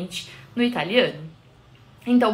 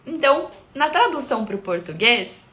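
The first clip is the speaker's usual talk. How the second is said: ordinary delivery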